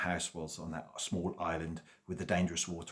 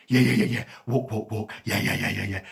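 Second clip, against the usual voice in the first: excited dog; 3 semitones higher, 9 dB louder than usual. A narrator's reading. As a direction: bark out the yeahs like a thrilled dog. Eager dog wants walk.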